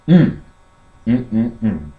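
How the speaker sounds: normal talking voice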